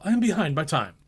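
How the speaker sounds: a hint of a frightened clerk; nervous clerk. A narrator's reading. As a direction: plead as a timid clerk caught late. Bob apologising.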